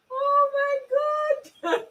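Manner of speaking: squealed oh my God through laughter; helpless high-pitched laughing oh my God; high laughing cry. Real, not acted. Laughing hard at herself.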